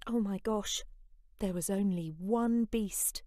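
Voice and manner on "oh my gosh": plain reading voice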